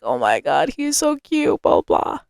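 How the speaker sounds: hushed retold swooning; quiet, tossed-off gushing quote; retold crush gushing. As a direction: softly mimic gushing over a crush. Gushing over her crush.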